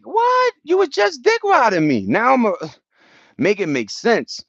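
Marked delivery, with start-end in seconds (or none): 0.1-2.1 mock indignant. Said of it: "It's like".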